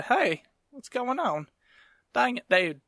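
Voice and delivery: put-on drawn-out Southern accent. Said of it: "'cause I opened up with the".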